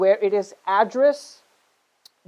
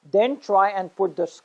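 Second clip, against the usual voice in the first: ordinary talk, nothing marked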